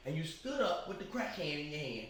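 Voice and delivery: ordinary delivery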